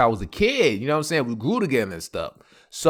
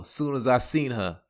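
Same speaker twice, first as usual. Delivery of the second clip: usual speaking voice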